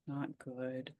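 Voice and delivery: put-on very soft, subdued child's reply; small child's admission. Quoting a young girl admitting it feels bad, very soft. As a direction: drop right down, imitating a chastened little girl. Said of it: "And she said".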